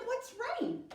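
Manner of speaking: high puzzled question; Noah puzzled. Acted. Noah asks God, high and puzzled. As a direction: ask it like you've never heard the word. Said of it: "And Noah said".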